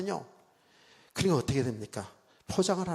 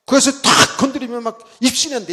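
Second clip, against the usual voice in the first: higher, louder gossip; excited telling; 5 semitones higher, 6 dB louder than usual. Real, not acted. Preacher retells revival rumour, louder and higher. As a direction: build up the rumour eagerly.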